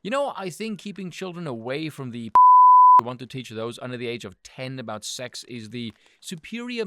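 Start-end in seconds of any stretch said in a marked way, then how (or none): 2.4-3.0 sudden loud laugh, much higher; burst of laughter. Real, not acted. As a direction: laugh breaks out mid-sentence. Bursts out laughing mid-sentence.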